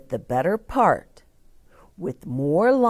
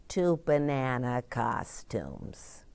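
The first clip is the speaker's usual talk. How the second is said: plain reading voice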